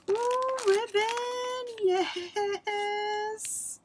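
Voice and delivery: high, drawn-out squeal of delight; squealing with real delight; excited squeal. Real, not acted. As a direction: coo over the ribbon like it's treasure. Delighted at gift ribbon.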